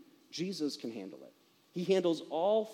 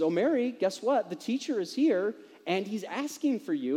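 eager teasing; 5 semitones higher, 5 dB louder than usual. Retold or imitated. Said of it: "she kind of baits Mary".

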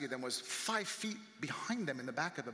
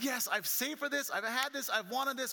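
high-pitched burst of acted excitement; excited; 9 semitones higher, 4 dB louder than usual. Buyer celebrates the long-saved-for truck, excited. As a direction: gush like a thrilled new owner. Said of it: "So your excitement of".

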